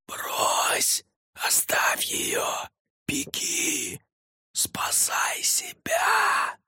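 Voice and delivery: slow, urgent whisper; sinister inner voice. A narrator's reading. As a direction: hiss it as a ghostly whisper. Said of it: "голос из Фаты".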